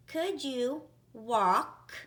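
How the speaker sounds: slow dictation reread. Shown as a demonstration. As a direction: stretch Could you walk out as you write. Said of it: "rereading the sentence while writing".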